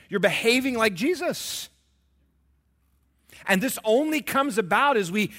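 loud, high, fast preaching shout; preaching at full force. Real, not acted. Pastor to congregation, loud and high. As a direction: drive it home to the congregation.

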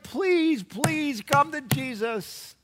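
high, drawn-out imploring; acted anguished plea, pitched high; desperate pleading. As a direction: beg your children with all your heart. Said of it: "look at your kids and you're like".

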